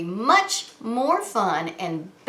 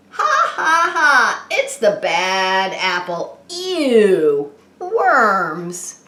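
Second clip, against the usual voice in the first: mocking taunt; 5 dB louder, much slower than usual. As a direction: jeer like schoolyard bullies, cackling. Bullies mocking the bad apple.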